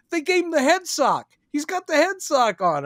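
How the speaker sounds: high, thrilled retold cry; high-pitched fan excitement; excited exclamation. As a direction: exclaim it high, in delighted amazement. Retelling a thrilled reaction to costume.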